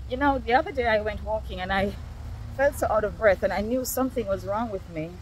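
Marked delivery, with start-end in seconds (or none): none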